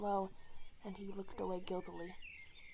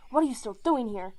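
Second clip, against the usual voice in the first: louder snarl, chasing them off; 2 semitones higher, 10 dB louder, faster than usual. A narrator's reading. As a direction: snarl it at the two intruders. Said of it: "he snarled".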